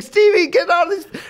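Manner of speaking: high-pitched imitation of a frantic diva; comically worked-up plea; mock yelling. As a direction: holler to be let out, hammed up. Acts diva trapped by child locks.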